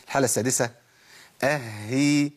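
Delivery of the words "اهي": "اهي" with a held double h, then long ee; doubled ha kasra. Shown as a demonstration. Teacher modelling the doubled state, "اهي" with h doubled. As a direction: linger on the h inside "اهي". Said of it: "اللي هو حاله تشديد".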